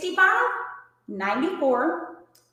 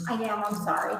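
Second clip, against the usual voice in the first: talking through a mouthful of mint; words around a mint, mouth full; 2 semitones lower than usual. Real, not acted. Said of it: "Talking with her mouth full".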